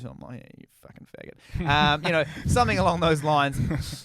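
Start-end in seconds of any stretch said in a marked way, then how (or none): 0.4-1.7 unvoiced mock insult under the breath; muttered jab. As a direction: drop to a near-whispered aside. Comic's aside mocking the cautious boyfriend.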